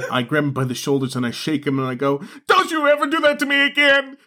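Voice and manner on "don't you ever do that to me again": very high, strained mock-hysterical cry; acted frantic scolding. Friend shaking a survivor by the shoulders, high frantic scolding. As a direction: scold in a high, hammed-up outburst.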